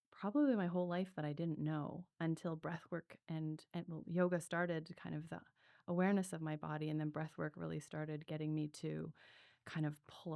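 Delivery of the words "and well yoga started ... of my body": ordinary talk, nothing marked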